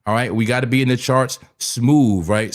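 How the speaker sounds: normal talking voice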